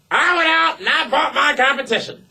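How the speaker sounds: high, laughing mock boast as the boss; mock Vince boast. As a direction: crow it like a smug owner, laughing.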